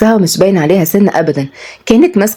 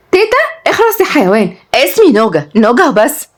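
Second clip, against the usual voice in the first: high-pitched indignant grandmother; affronted high-voiced scolding; offended outburst; 7 semitones higher than usual. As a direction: snap back, outraged, pitch shooting up. Grandmother offended at being called granny.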